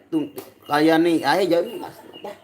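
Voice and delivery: ordinary delivery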